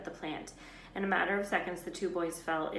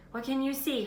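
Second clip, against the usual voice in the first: anxious; 8 semitones higher, 6 dB louder than usual. A narrator's reading. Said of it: "Hermione said anxiously".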